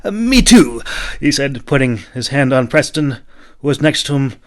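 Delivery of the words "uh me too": high, loud, hurried; eager knight chiming in; quick agreement. A narrator's reading. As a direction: jump in fast to agree. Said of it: "agreed quickly".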